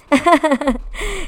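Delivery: high, loud laugh; real amused laugh; burst of laughter. Real, not acted. Laughs at own comeback.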